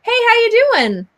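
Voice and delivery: ordinary talk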